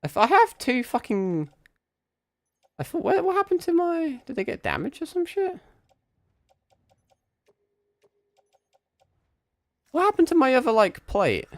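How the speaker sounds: high put-on bewildered voice; squeaky confused voice. Confused about missing armor.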